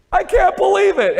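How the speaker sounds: high, loud mock wail; teacher mimicking the student's tearful disbelief; imitating a crying girl. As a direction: howl it like a heartbroken teen. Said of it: "She started crying".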